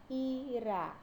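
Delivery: "Irá" drawn out as I, then rá; split into syllables. Shown as a demonstration. Teacher giving a two-syllable example, "Irá" pulled apart. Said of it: "Quando a gente vai separar".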